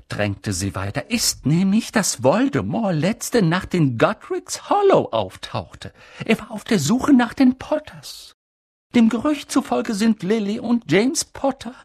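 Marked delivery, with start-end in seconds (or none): 1.1-11.7 high, urgent voice of an agitated stern professor; stern witch teacher. A narrator's reading. Professor McGonagall pressing Dumbledore for news, high and urgent. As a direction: press for gossip like an anxious headmistress.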